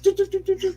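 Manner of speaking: high mock beeping like a reversing truck. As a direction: beep high like a truck backing up. Cat walking backwards like car.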